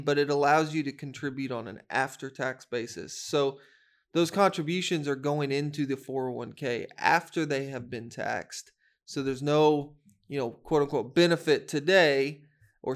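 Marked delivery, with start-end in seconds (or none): none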